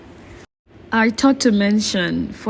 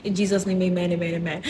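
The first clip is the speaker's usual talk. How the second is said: quiet, softer closing prayer; 6 dB quieter than usual. Real, not acted. Vlogger closing a prayer before filming, quiet and reverent. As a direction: murmur the last words of a prayer.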